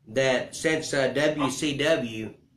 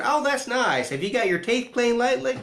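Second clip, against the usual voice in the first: much higher, mock motherly voice; mom's reply; 9 semitones higher than usual. Cody's mom brushing off her son's big news, high mock-motherly voice. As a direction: play his mom, sweetly brushing off the news.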